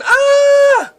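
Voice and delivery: high, loud, drawn-out scream. A narrator's reading. David and Ashley spotted by zombies, screaming. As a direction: scream out at sudden danger. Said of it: "David and Ashley scream".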